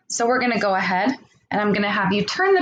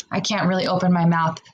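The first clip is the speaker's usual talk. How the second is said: ordinary talk, nothing marked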